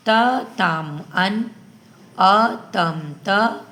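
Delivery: अ तम त drawn out slowly and loud, each syllable apart; slow recitation. Shown as a demonstration. Teacher reciting verb endings to pupils, slow and loud. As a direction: chant the endings slowly for the class. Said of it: "और एक बार हम पढ़ा देते हैं".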